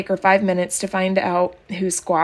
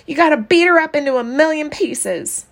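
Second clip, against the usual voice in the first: put-on angry child; 7 semitones higher than usual. A narrator's reading. Maybelle demanding revenge.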